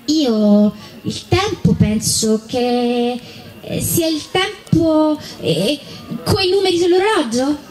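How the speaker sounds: acted puzzled child, unsure and slow; hesitant child. A narrator's reading. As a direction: think aloud with long drawn-out pauses. Child puzzles out what time is.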